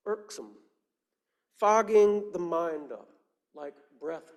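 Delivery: slow, measured poem reading with long pauses; poetry recital. Acted. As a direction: recite it phrase by phrase, letting each image hang.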